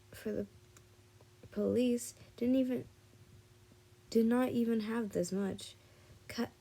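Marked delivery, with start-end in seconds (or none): none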